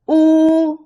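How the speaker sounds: "Wu" high, loud and level; first tone. Shown as a demonstration. Teacher leads repeat drill, high level wu. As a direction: hold "Wu" on one high note. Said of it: "The first tone is the highest".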